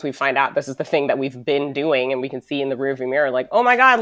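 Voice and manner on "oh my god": high, loud startled oh my God; a sudden jolt of realization, played up; mock shocked gasp. Picturing her past self spotting the mess, mock shocked. Imagined realization, looking back.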